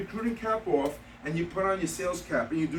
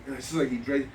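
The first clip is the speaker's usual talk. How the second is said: usual speaking voice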